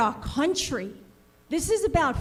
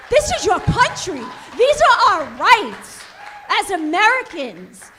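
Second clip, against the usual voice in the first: loud indignation; 4 semitones higher, 7 dB louder than usual. Real, not acted. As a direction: hammer home rights to the board. Angry parent confronts school board.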